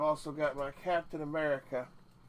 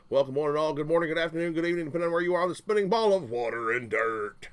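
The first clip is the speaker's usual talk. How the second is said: announcer; 2 semitones higher, 4 dB louder, faster than usual. Acted. Showman greeting.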